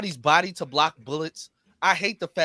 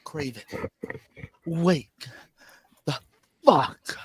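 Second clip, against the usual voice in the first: hammed-up dramatic plea with long pauses; one word at a time, exploding on the last; overdramatic; 3 semitones lower, 6 dB louder, much slower than usual. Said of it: "why is she so dramatic with it".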